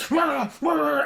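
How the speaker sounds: high shouted mock barking; hammed-up barking; mock dog bark. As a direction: yap it out like a dog at a fence. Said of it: "go like".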